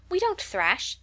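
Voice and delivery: high-pitched indignant protest. A narrator's reading. Girl objecting to her hostess's remark, indignant. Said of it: "cried Clover indignantly".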